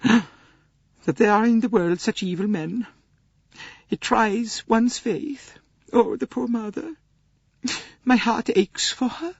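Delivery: high, slow, aching Swedish lady; slow and pained, grieving for the mother; indignant sorrow. Acted. Swedish lady hearing of the kidnapping, slow pained indignation. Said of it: "Greta Olson was indignant".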